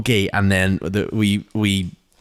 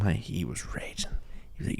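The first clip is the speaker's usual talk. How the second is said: hushed aside about dad; whispering; 14 dB quieter than usual. Retold or imitated. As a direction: drop to a whisper. Hushed retelling of angry dad.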